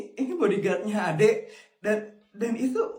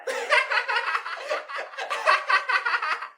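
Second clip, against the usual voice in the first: high mocking cackle; mocking laugh; 12 semitones higher than usual. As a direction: laugh down at the kid. Bully mocks poor kid.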